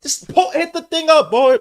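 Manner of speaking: high, loud urgent shout; real urgent yell at the screen. Real, not acted. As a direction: shout the order at the player. Yelling at player to poke ball.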